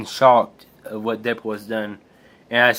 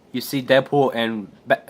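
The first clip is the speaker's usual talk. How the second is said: ordinary talk, nothing marked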